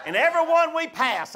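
ordinary delivery

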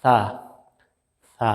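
সা with the tongue tip on the upper teeth and a light breath, like English th in thin; Arabic tha sound in সা, soft and airy. Shown as a demonstration. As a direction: breathe out gently through সা, tongue touching the teeth. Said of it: "হালকা বাতাস বের করে".

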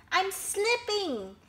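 high, drawn-out wail; alarmed cry. A narrator's reading. Elephant slipping toward crocodile, crying out. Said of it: "cried elephant".

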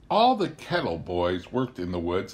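plain reading voice

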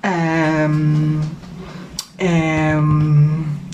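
low, long drawn-out ums; slow, unsure frog thinking; worried hesitation. A narrator's reading. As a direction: stall uneasily on a low hum. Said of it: "is a little bit worried".

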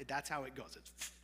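ordinary delivery

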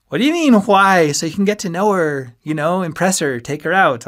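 high, loud, disbelieving outburst; incredulous. A narrator's reading. Friend urging him to date a girl, loud and incredulous. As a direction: push it up high, then rattle off the reasons. Ski amazed at friend's "why.

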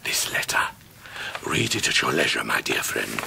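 secretive soft whisper. Acted. Micawber slips his friend a letter in secret, whispering. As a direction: slip it to him under your breath.

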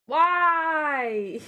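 high drawn-out wail of why; anguished why. Real, not acted. Gamer wailing why after losing her item. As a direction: moan it up at the ceiling.

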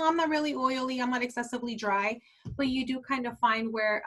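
normal talking voice

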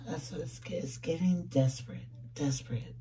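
soft, whispered aside. Real, not acted. Reader confirms what the card is giving, whispered. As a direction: whisper it as a quiet verdict.

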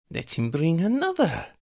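high, cold voice of a female vampire plotting murder; scheming vampire woman. Acted. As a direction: savour each threat like a predator. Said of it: "she suggested".

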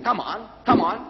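retold impatient prodding, high-pitched; high, insistent mock impatience. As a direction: demand the answer as an exasperated questioner. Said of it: "the Reverend again started stamping".

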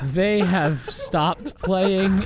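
loud, drawn-out amazed exclamation; amazed reaction. Real, not acted. Stunned reaction to a game play.

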